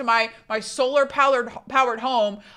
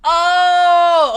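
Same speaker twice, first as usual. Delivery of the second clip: sharp gasp, then a loud high oh; shocked gasp; 8 semitones higher, 8 dB louder than usual. Real, not acted. Looking up B D M.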